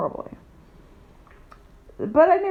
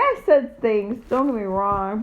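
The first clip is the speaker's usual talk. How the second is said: ordinary delivery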